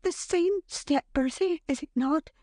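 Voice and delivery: high, mounting excitement in short bursts; agitated, high-pitched suspense; panting anticipation. A narrator's reading. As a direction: count down the arrival, keyed up. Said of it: "she panted".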